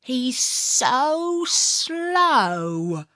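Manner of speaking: usual speaking voice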